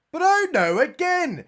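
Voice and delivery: hammed-up disbelief; mock dismay. Mock despair at another alien.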